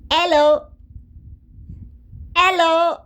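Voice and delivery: imitating Egyptians: hello without h, loud and high. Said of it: "every Egyptian would answer".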